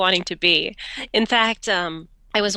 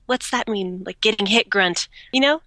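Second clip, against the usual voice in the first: ordinary talk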